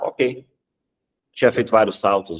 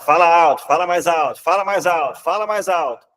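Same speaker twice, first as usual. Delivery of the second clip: higher, louder coaching call; urging louder; 7 semitones higher, 3 dB louder than usual. Retold or imitated. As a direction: push him to speak up.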